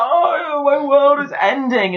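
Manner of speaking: high, slow hammed-up wail; mock despair. Mocking the drunk king, high slow hammy wail. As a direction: wail it out like a tragic hero. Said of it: "And he's like".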